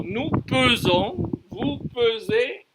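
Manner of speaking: slow PESONS and PESEZ with a rounded "puh"; careful phonetic contrast. Shown as a demonstration. Teacher warning about phonetics, slow exaggerated vowels. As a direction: stretch the first syllable of PESONS and PESEZ as "puh". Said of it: "attention à la phonétique".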